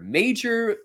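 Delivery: "Major" jumped up high in pitch; a lifted, emphatic "Major". Shown as a demonstration. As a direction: sing out "Major" on a high note. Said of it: "repeated word major".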